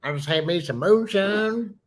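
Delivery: thick hillbilly twang, rising into the punchline; backwoods drawl. Acted. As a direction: play a moonshine-drinking backwoods local. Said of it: "that real thick backwoods drawl".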